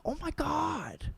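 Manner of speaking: high, slow, soft moved gasp; touched actor. Retold or imitated. Actor hearing the movie made her cry, high and soft. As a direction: sound genuinely touched. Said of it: "he's like".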